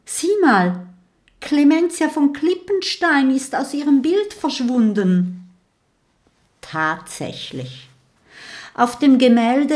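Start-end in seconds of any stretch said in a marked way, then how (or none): 0.0-5.5 high, raised voice of discovery; calling out. A narrator's reading. Mathilda alerts her friend to the empty picture, calling out high. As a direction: call it out, pitched up high. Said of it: "ruft Mathilda".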